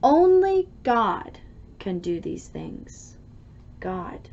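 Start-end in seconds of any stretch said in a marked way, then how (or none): none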